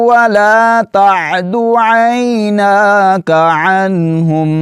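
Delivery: slow high melodic tilawat; Quran recitation. Acted. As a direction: recite slowly with long drawn vowels.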